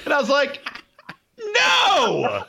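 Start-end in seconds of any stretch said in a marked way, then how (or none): 1.4-2.4 high, drawn-out "no"; shouted refusal. Retold or imitated. Man refusing a groper in the alley, shouted refusal. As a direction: cry out a long, shocked no.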